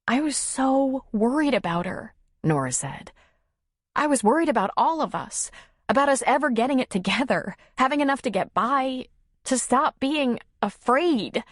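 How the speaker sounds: high-pitched, earnest woman's voice; emotional Nora. Acted. Nora confessing her worry for the family, emotional. Character dialogue.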